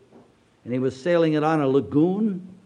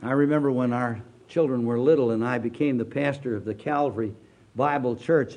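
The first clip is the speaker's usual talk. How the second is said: usual speaking voice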